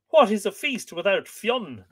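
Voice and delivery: high-pitched protest; complaining. A narrator's reading. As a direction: complain as a disappointed crowd. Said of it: "they complained".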